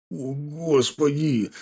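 slow, drawn-out despairing sigh of words; clutching his head in despair; husband despairs at his foolish wife; exasperated groan. A narrator's reading. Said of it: "схватился за голову Епихин".

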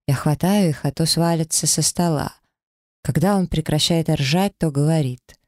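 ordinary delivery

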